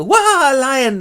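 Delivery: high, loud mock terror; hammy scream. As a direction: shriek at a stage lion. Thisbe sees the lion.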